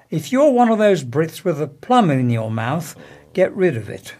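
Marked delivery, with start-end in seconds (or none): none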